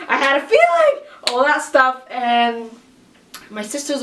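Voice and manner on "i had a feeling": high, loud excited cry; pitched-up exclamation, reliving the moment; re-enacted shock. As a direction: cry it out, overwhelmed with realization. Reliving her screaming shock at result.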